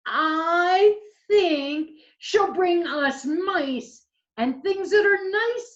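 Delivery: high, slow, loud little owl voice; high-pitched story-time owlet; hopeful owlet. Acted. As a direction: draw it out high like a baby owl reassuring siblings. Voicing Sarah owlet in story.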